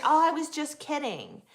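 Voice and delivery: high, breezy "just kidding" brush-off; mock excuse. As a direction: imitate someone waving it away. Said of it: "then they're".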